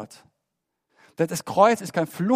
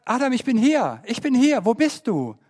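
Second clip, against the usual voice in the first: higher, louder calling Adam; God calling out; 6 semitones higher, 6 dB louder than usual. Acted. Preacher voicing God calling for Adam, loud and high. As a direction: call out across the garden, searching. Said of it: "Gott sagt".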